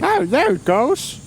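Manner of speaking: put-on high, slow creaky old man; whiny nasal old person. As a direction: croak it like a whiny old geezer. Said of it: "Is that your old man voice?".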